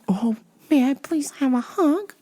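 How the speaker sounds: high, breathy polite pleading; fake sweetness. Homeless woman asks for a hug, breathy fake sweetness. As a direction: ask daintily in a whispery high voice.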